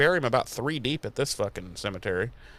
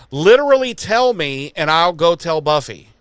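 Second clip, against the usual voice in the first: higher, louder, eager tattletale; eager outburst; 4 semitones higher, 7 dB louder than usual. Acted. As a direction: blurt it out keenly. Anya eager to snitch.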